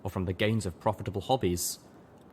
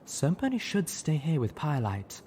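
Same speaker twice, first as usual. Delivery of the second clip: a hint of a huffy, higher mare; lightly voiced Velvet Remedy; 7 semitones higher than usual. A narrator's reading. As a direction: huff it out.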